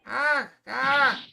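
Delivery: loud high panicked screams. Acted. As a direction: scream as the shots hit. Shots fired, victim screams.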